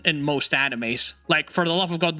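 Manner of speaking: ordinary delivery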